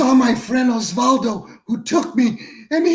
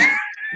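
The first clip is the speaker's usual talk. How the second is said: burst of laughter; 5 dB quieter than usual. Retold or imitated. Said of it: "he starts cracking up, laughing".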